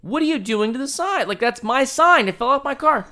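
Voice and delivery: mock yelling. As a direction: yell across traffic that it's yours. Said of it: "I'm, like, yelling".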